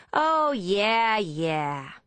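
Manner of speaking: loud Texan woman, high then dropping; Texan accent. A narrator's reading. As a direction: answer loudly in a Texan drawl. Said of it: "in an accent similar to Deborah's Texan friend".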